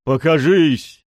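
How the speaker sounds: loud, high, drawn-out order; commanding shout. A narrator's reading. Pushkin orders the unseen intruder to appear, commanding shout. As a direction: demand that the hidden one come out.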